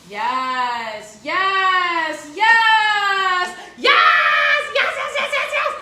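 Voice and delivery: drawn-out yeses climbing to a shout; excited crescendo. Real, not acted. Cook cheering over her pot, building excitement. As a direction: build to high, loud, rapid-fire squeals.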